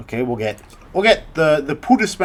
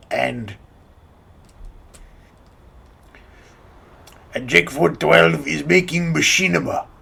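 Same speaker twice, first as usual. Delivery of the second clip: put-on Russian accent, loud on the first word; a lightly voiced big Russian mercenary; Heavy impression; 3 dB louder, slower than usual. YouTuber doing his Heavy voice for a machinima tutorial, Russian accent. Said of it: "it's my best Heavy impression".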